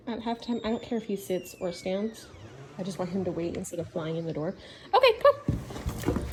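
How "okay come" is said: high, drawn-out release call; calling the dog. Real, not acted. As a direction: sing out high to let the dog come.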